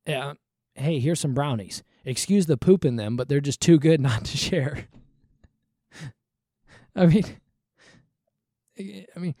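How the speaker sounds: ordinary delivery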